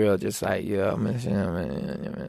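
deep, quiet rap voice; low voice. Shown as a demonstration. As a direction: drop it down low and cool. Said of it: "is down here".

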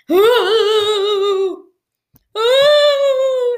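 high, loud drawn-out ghost "ooh"; ghostly wail. Pretend. Podcaster voices the attacker, long ghostly wail. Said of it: "He sounds like a ghost".

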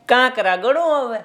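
mischievous teasing. Acted. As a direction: tease the miserly merchant. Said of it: "ભારે મસ્તીખોર હતો". (મસ્તીખોર પાડોશીની મશ્કરી)

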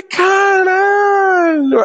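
long, high-pitched drawn-out cry; mock horror. Nephew learning dad got the photo, mock horror. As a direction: stretch the swear into a hammed-up wail. Retold shock at photo being sent.

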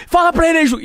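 loud, high-pitched mock yell; shouted quote of an angry man; retold shout. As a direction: bark it out, re-enacting a row. Retold angry confrontation over Juca.

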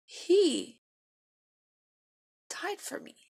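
choked up, voice pitched high and breaking away at the end; holding back tears. Real, not acted. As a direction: fight back real tears, fading to almost nothing. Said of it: "I'm gonna try not to cry".

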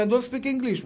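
high-pitched, soft imitation of halting English; flustered non-English speaker. Traveler pleads with immigration officer, high flustered imitation. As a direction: play the helpless traveler, voice lifted high.

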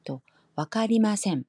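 ordinary delivery

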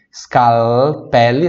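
"scalpelli" slowly with a clear L; careful "scalpelli". Shown as a demonstration. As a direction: stretch "scalpelli", linger on the L. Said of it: "atenção para o som do L aqui".

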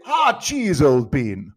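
hammed-up British consolation; hearty British commiseration. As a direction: call out like a jolly British sportsman. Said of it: "somebody will say".

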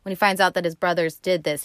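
ordinary talk, nothing marked

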